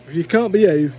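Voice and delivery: loud, high Geordie foreman at his limit; fed-up Geordie. Retold or imitated. Foreman Dougie tells off prankster Robert, loud Geordie accent. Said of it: "in his Geordie accent".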